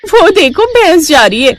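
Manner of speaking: high, sing-song jeering at a passerby; mocking taunt. Neighbour jeering at Shalu as she leaves home, high mocking. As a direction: taunt her like a schoolyard bully. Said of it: "उसे भैंस कहकर चिढ़ाने लगती है".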